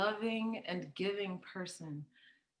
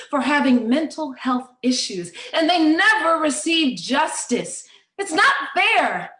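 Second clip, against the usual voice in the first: loud, rising outrage; impassioned and indignant; indignant protest; 4 semitones higher, 6 dB louder than usual. Acted. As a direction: build to a high, forceful cry of injustice. Protesting injustice to mentally ill.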